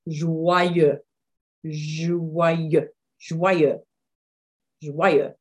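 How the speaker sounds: Joyeux said over and over, French zh sound at the start; repeated drill. Shown as a demonstration. Teacher repeating a hard word for students. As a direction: drill Joyeux slowly for learners to copy. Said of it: "I'm gonna say this a few times".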